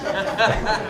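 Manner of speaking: high, airy burst of laughter; real laugh. Real, not acted. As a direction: laugh at how absurd it was. Laughing at the absurd negotiation.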